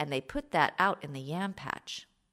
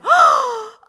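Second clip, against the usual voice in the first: high loud gasp of shock; 12 dB louder than usual. Acted. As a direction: gasp as you recognise him. Eso shocked at finding Ananse.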